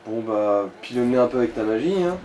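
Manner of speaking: ordinary delivery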